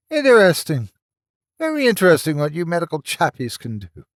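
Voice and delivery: high, quick, gloating falsetto; falsetto villain. Acted. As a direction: purr it high and pleased. Grant villain voice.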